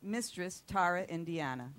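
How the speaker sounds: booming show-host intro; announcer voice. Acted. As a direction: sell the guest like a showman. Hosts a show intro over music.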